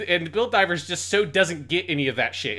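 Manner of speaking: usual speaking voice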